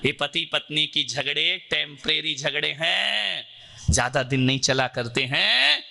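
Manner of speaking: grave preacher's warning; solemn sermon. Acted. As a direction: lean in and warn the congregation.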